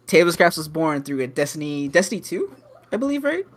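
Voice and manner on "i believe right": ordinary talk, nothing marked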